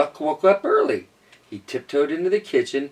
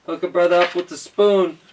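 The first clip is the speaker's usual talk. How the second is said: duck wanting spoon; 5 semitones higher, 6 dB louder than usual. Acted. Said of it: "Duck's like".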